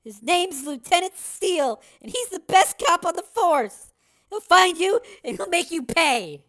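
put-on very high, loud, impassioned girl; defiant threat. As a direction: hurl the warning at your captor. Girl threatens captor with her father.

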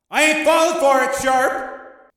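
very high, loud defiant yell. Acted. Gangster defies agent from the seats, yelling. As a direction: holler it down across an arena. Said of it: "Tommy yelled down to the arena".

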